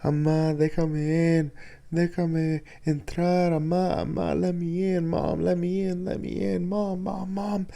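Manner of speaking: frantic high-pitched calls for mom; panicked pleading yell. Retold or imitated. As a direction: beg to be let in, terrified.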